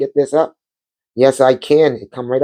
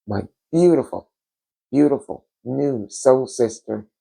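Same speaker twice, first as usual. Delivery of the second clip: ordinary talk, nothing marked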